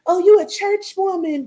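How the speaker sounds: mock her snide remark up high; mocking Bree's mother. Imitating Bree's mother taunting her, high sneering mimic. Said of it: "Then Bree mother come up on my shit".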